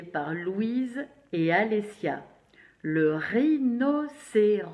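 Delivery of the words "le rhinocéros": "rhinocéros" slow and louder, each syllable separated; careful syllables. Shown as a demonstration. Teacher presenting an animal sent by Louise and Alessia, careful syllables. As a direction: announce "rhinocéros" firmly, syllable by syllable. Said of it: "ce mot-là est difficile à prononcer".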